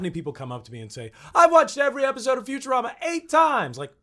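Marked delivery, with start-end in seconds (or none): 1.3-3.8 high, loud, overeager fan voice; gushing fan. Retold or imitated. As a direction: play an obsessed superfan. Imitates gushing fans.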